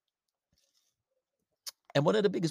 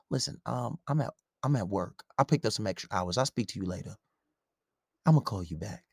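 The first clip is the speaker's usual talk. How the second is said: fake calm excuse; 9 semitones lower than usual. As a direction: lightly play a guy brushing her off at work. Acting out a lie to girlfriend.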